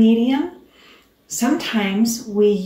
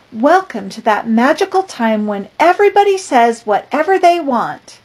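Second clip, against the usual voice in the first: put-on lively, louder storybook reading for kids; picture book read-aloud; 3 semitones higher, 7 dB louder than usual. A narrator's reading. Teacher reading a picture book to her class, lively.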